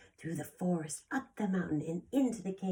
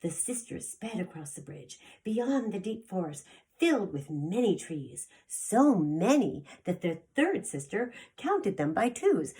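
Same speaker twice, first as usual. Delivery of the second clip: story narration; 3 semitones higher, 5 dB louder than usual. A narrator's reading. Reading story to children.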